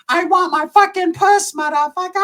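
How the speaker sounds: put-on high, slow Chow-style accent; Mister Chow. Mister Chow quoted as the comparison, slow and high. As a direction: do the Hangover gangster line. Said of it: "like having a conversation with Mister Chow".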